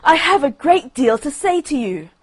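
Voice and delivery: urgent shouted plea; loud call. Acted. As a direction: call out so she hears. Rocket keeps calling after duck.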